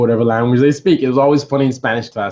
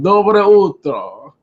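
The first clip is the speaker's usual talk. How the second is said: mistaken Russian greeting; 7 semitones higher, 5 dB louder, much slower than usual. Retold or imitated. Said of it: "I made a mistake".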